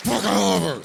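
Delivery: cursing with helpless love; loud fond outburst. Acted.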